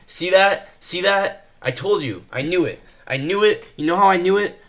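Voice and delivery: real excitement at being proved right; excited vindication. Real, not acted. Trader crowing to viewers that the call landed, excited. Trader's prediction just came true.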